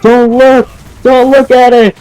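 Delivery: very high, urgent mock yell; re-enacted panicked shout; retold yell. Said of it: "we just yell".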